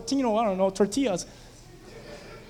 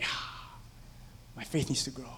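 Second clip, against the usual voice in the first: sheepish; 8 semitones lower, 14 dB quieter than usual. Retold or imitated. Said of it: "And you're like".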